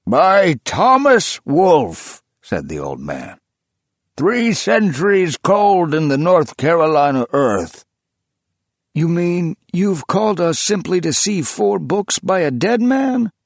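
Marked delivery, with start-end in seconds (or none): 0.1-2.2 high, loud, very slow announcement; reverent proclamation. A narrator's reading. Old man revealing the author to guests, high slow loud. 4.1-7.8 put-on excited old collector. A narrator's reading. Old man to his gathered guests, high loud feverish. 8.9-13.3 rising high, incredulous protest; protesting friend. A narrator's reading. Friend to the old man, high incredulous protest. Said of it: "his friend protested".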